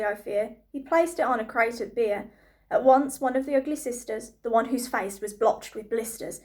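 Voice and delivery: ordinary delivery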